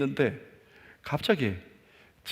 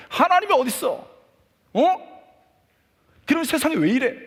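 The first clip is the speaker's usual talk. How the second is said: much higher and louder, an angry yell; furious retold shout; 12 semitones higher, 8 dB louder than usual. Angry teen boy shouting at God during the service. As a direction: hurl the question at God in rage. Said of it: "막 분을 내는데".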